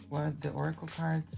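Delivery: ordinary talk, nothing marked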